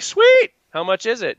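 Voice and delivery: squeaky high Sweet, then asking; excited sweet. Retold or imitated. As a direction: jump way up on Sweet. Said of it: "And I'm like".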